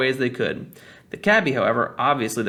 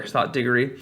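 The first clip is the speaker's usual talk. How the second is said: ordinary delivery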